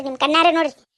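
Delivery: ordinary talk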